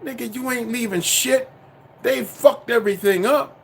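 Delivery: normal talking voice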